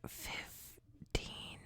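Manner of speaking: whispered bad news; dramatic whisper. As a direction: breathe it like a secret. Dramatic news about the cuts.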